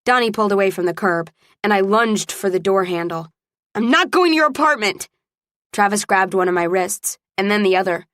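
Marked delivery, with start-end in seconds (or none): 3.7-5.1 high, indignant refusal; angry refusal. A narrator's reading. Woman lunging for the car door refuses, furious. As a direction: refuse hotly, voice pitched up.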